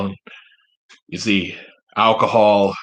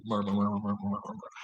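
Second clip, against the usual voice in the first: soft monotone mur-mur-mur; imitated crowd murmur; 13 dB quieter than usual. Senators murmuring after being scolded, soft mock mumble. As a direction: mumble like a muttering crowd, softly.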